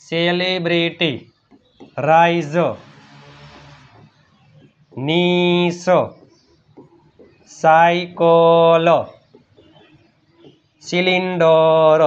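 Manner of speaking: Celebrity slowly, syllable by syllable; drawn-out sounding-out. Shown as a demonstration. Teacher repeats celebrity for students, slowly. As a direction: stretch out each syllable of celebrity.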